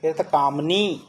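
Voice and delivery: ordinary talk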